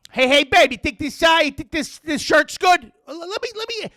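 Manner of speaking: high, loud Brooklyn rant; hammed-up Brooklyn guy, loud and high; Vinny movie scene. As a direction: belt it out like Vinny quizzing his girlfriend. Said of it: "It's Marissa Tomei".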